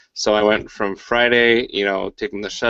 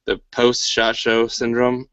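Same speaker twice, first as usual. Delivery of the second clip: ordinary talk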